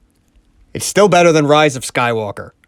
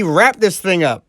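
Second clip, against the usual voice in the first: normal talking voice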